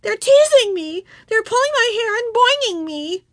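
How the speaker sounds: put-on high-pitched wailing little girl; upset child telling on others; crying complaint. A narrator's reading. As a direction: whine it tearfully to the teacher. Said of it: "she cried".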